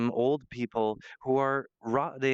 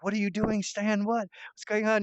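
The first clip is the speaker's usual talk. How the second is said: high-pitched, bewildered questioning; baffled audience; 8 semitones higher than usual. Acted. Viewers reacting to Stan messing with the robot, baffled. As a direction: question him in disbelief. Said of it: "the audience is".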